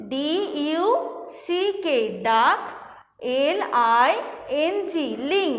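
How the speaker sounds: ডি ইউ সি কে spelled out letter by letter, then ডাক and লিং as chunks; teacher spelling syllable by syllable; spelling aloud. Shown as a demonstration. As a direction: break the word apart slowly for the class. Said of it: "এইভাবে ভেঙে ভেঙে উচ্চারণ করবে".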